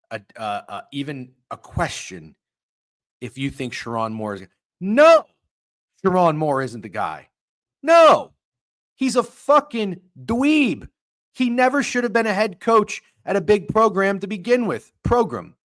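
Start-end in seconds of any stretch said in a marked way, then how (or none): 4.8-14.9 high, loud furious outburst; angry yelling. Real, not acted. Streamer shouting to chat that the Michigan coach is a dweeb. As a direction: explode at the idea he's the guy.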